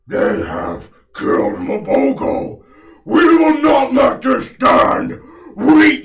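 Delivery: loud, high, strained croak of outrage; croaking frog king. Acted. Frog Priest King rallying his Boggards, croaking out in rage. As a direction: croak a furious proclamation to the troops. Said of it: "as he begins to croak out".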